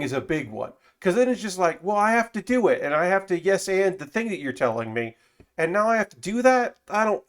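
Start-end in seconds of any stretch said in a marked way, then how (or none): 1.8-7.2 higher-pitched, put-upon improviser; mock protest at being forced into it; acted reluctance, pitched up; reluctant protest. Acting out a pimped improviser's reluctance.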